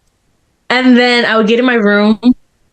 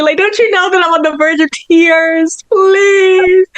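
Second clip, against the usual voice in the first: exasperated plea; 8 semitones higher than usual. Retold or imitated. Retold frustration at friends.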